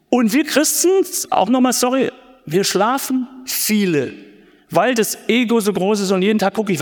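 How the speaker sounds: ordinary delivery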